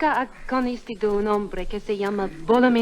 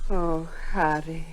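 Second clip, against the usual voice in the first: slow, soft, melting; tender sigh; 5 semitones lower, 8 dB quieter, slower than usual. Acted. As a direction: let the name melt out slowly. Alicia yields tenderly.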